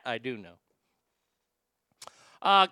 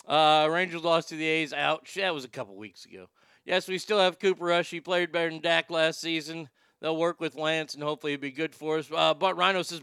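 ordinary talk

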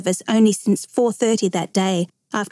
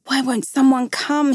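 mild cry for help, pitched higher; 4 semitones higher than usual. A narrator's reading. Trapped boy calls for someone to come, crying out. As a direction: call out in understated desperation. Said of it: "he cried aloud".

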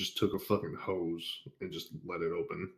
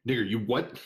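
louder, sharp rise of disbelief; incredulous; 3 semitones higher, 5 dB louder than usual. Retold or imitated. Teller reacts to his friend's confession, incredulous. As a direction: react in stunned disbelief, acted out.

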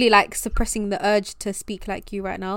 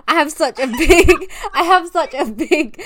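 higher, louder, breaking into laughter; laughing; 6 semitones higher, 8 dB louder than usual. Real, not acted. Cracking up at own "big tooth" slip, laughing. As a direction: laugh through a slip of the tongue.

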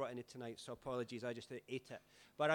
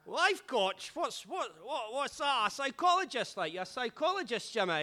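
much higher, loud, baffled slurring; drunk Scotsman; 8 semitones higher, 7 dB louder than usual. Retold or imitated. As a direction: play the drunk confused and loud. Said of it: "drunk pal baffled".

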